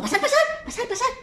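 high, laughing hurry-in; eager big brother pig. Acted. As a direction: rush them inside with a giggle. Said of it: "y le dijo".

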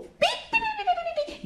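very high rapid pi pi chirps; quick high peeping; bird chirping. Acted. Bird chirps between words.

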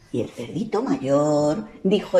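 usual speaking voice

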